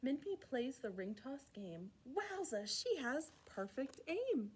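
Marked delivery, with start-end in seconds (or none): none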